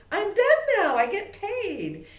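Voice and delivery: very high and loud, then slow on "I get paid"; thrilled dog. Retold or imitated. Trainer voicing her setter expecting her greenie, high and loud. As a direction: gloat like a dog collecting her reward. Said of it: "'Cause she knows".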